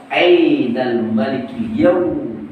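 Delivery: slow thundering question to all creation; proclaiming shout. Acted. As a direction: stretch it out as a cosmic proclamation. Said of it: "kalau udah teriak".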